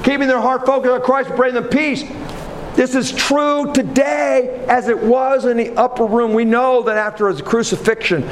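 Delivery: high, pressing preacher's assurance; fervent preaching. Real, not acted. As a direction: drive the promise home with fervour.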